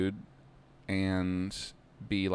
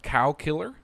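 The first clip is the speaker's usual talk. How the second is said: loud, high disbelieving question; can't believe what he heard; incredulous; 7 semitones higher, 6 dB louder than usual. Real, not acted. Incredulous at insult.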